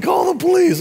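a squealing cry for help, pitched high; panicked plea. Retold or imitated. Pinned man begging the other pastor, in a panicked high squeal. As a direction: shriek it like you're pinned down.

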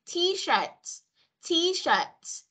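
"T-shirt" with high "T" and released final T; clear final T. Shown as a demonstration. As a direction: puff out the last T of "T-shirt". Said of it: "Âm th".

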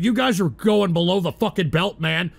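ordinary delivery

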